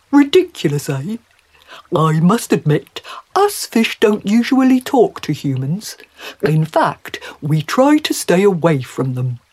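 loud, put-on fish character voice; confiding fish, amused at itself; chatty talking trout. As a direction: play a talkative, well-mannered trout.

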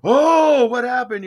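loud, high drawn gasp of alarm; crowd's outcry. Retold or imitated. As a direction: imitate a startled crowd. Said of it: "the crowd's going".

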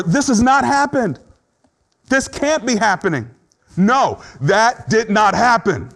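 high, emphatic refusal, slowing on the last words; denial. Acted. Preacher voicing a mourner who rejects the loss, in insistent denial. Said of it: "That's denial".

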